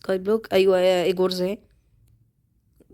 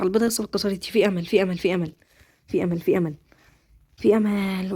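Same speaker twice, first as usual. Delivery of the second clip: normal talking voice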